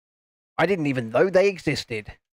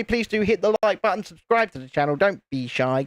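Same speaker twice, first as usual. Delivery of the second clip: ordinary talk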